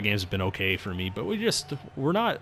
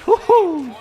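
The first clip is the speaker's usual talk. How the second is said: excited whoop; 8 dB louder than usual. Real, not acted. Cheering a scoring chance in game.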